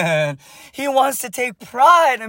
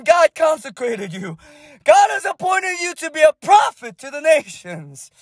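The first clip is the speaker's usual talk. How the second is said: preaching at full force; 4 semitones higher than usual. Real, not acted. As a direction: cry it out over the people like a prophet. Imparting prophecy over the congregation.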